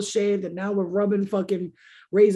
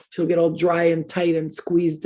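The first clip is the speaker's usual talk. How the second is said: ordinary talk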